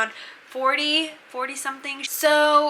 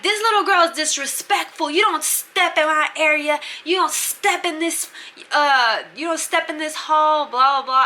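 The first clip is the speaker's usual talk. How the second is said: higher-pitched imitation of a ranting bully; hammed-up indignant tirade; mock angry rant; 5 semitones higher, 3 dB louder than usual. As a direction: imitate someone going off on a girl. Bunkie ranting in retold quarrel.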